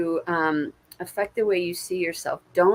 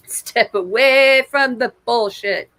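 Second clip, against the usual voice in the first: louder, slow and firm; emphatic command; 4 semitones higher, 8 dB louder, slower than usual. Acted. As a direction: lay down the law, word by word. Spirit message delivered emphatically.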